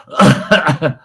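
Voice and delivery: loud high laugh. Real, not acted. Laughing at his brother's dark humor. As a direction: laugh out at the memory.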